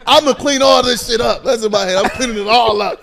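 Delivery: keen newcomer vowing loyalty in his head; eager inner voice. Retold or imitated. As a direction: play the eager rookie promising the coach. Eager inner monologue to coach.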